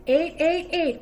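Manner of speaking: loud high auction call; calling out bids. Acted. Auctioneer calling the bid to the miners, loud. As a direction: holler the bid across the crowd. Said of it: "called the auctioneer".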